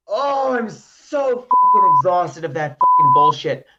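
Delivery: exasperated slow venting; fed up and furious; angry rant. Real, not acted. Rant about hated cards.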